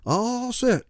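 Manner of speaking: put-on high old folks calling back; crew hollering. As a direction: call back like old passengers. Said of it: "the crew hollered".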